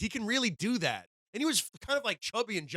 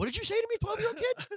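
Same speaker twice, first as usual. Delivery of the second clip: high, exaggerated mock disbelief; stunned reaction; faster than usual. Imitating people answering Tyson's threat, incredulous. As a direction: pitch it way up, baffled and indignant. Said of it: "people would be like".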